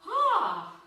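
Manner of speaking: sharp high gasp of effort; pained gasp. Real, not acted. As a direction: cry out as the abs burn. Abs burning during crunch.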